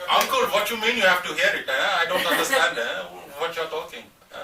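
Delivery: playful Nigerian uncle, mildly put on; Nigerian accent. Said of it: "my Nigerian accent".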